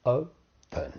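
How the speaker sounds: "open" in two separated syllables, o, pen; split syllables. Shown as a demonstration. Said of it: "two syllables".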